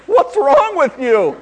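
loud, high, drawn-out incredulous outburst; mock disbelief. Preacher reacting to people who forget to eat, comic disbelief. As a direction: exclaim it slowly as if appalled.

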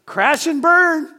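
high, loud exclamation; playful doomed cry at full volume; mock crash alarm. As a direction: shout it as the plane goes down. Ends mock plane alarm.

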